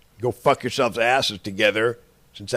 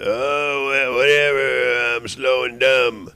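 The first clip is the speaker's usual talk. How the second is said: drawn-out slow mock of a dim person; mocking dumb voice; 3 dB louder, slower than usual. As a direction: sneer it out like a dull slacker. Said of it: "that's what you're acting like".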